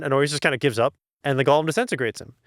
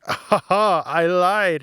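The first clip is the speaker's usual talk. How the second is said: smug, higher mock gloat over a lie; 5 semitones higher, much slower than usual. Nathaniel gloating at Kitty over his lie, smug. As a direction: crow about the lie, pleased with yourself. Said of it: "and then Nathaniel's like".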